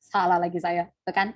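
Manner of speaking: ordinary talk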